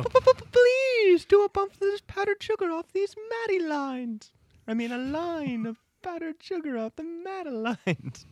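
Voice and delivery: high, stuttering toon plea; squeaky, frantic rabbit begging; cartoon rabbit voice.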